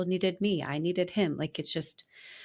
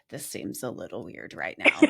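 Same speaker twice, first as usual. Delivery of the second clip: much lower hushed imitation of the patient; mock hushed remark; 12 semitones lower than usual. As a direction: say it low, like a polite aside. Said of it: "He kinda whispered it to us".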